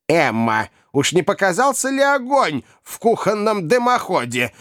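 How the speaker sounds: put-on high-pitched old lady's querying voice. A narrator's reading. Old lady asking about a kitchen chimney fire, high old-lady voice. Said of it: "осведомилась старая леди".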